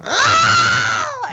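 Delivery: high, loud turtle cry; turtle noise. Retold or imitated. Retelling a character's animal lesson, imitated turtle screech. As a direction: let out a startling mock turtle noise. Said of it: "the actual hell was that noise".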